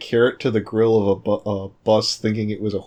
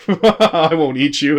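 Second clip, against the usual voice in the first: mock reassurance; 4 semitones higher, 4 dB louder, much slower than usual.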